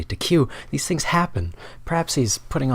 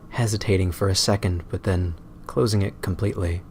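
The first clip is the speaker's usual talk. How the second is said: ordinary delivery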